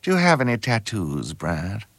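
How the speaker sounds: affected telephone voice, softly trailing down; mother's telephone voice. Acted. Actor showing the voice he borrowed from his mother, telephone voice. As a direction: put on mum's best phone manner. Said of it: "it was sort of her telephone voice".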